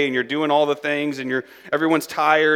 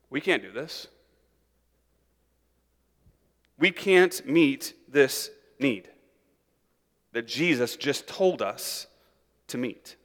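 softer, slow, resigned; defeated conclusion; 3 semitones lower, 6 dB quieter, slower than usual. Retold or imitated. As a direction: give up quietly. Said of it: "their conclusion is".

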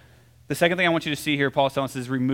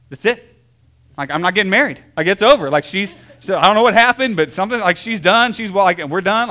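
retold panic; 3 semitones higher, 6 dB louder than usual. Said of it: "I'm like".

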